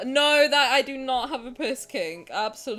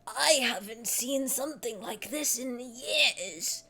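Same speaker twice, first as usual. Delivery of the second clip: put-on slow, drawn-out old-timer voice; old creaky character voice; 4 dB quieter, slower than usual. Shown as a demonstration. Pitching herself as a voice actor, a slow old-man voice. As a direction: creak it out like an ancient game elder. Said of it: "one of those old ones with".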